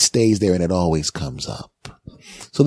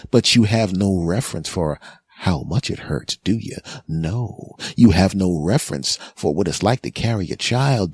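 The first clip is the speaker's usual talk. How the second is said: ordinary delivery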